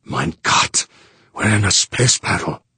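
startled realisation, lifting in pitch. A narrator's reading. Pilot realising he's in a space battle, startled. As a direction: gasp it out as a stunned thought. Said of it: "heard a startled voice in his head say".

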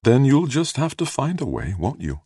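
high, lilting, smiling challenge; sweetly encouraging, high-voiced; gentle smile. A narrator's reading. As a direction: tease him gently, pitch lifted then dropping on the tag. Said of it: "Felicia smiled gently at him".

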